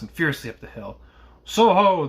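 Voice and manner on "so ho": loud, high sung-out challenge; roaring call. A narrator's reading. Guard challenging a rider in the mist, roaring. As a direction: sing it out at a roar. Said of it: "sang out as loud as he could roar".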